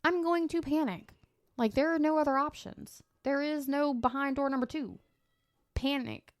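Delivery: comically adamant, high-pitched declaration; emphatic insistence. Acted. Insists panic is the only option.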